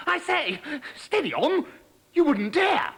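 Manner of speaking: high, flustered frog protest. Acted. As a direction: squeak out an alarmed objection. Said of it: "protested the dangling Pipsqueak".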